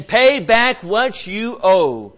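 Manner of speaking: creditor's harsh slow demand. Retold or imitated. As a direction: demand the debt back slowly.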